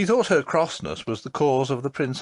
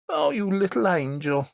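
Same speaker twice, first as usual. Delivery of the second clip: raised, tender exclamation; fond cry; 8 semitones higher than usual. A narrator's reading. Nurse cries out to the forgiving princess, fond. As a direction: gush over the child with sudden love. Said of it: "cried the nurse".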